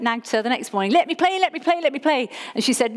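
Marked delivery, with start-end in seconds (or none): 1.0-2.2 nagging. Retold or imitated. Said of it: "I nagged her".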